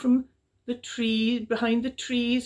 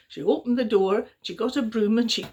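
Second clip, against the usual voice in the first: plain reading voice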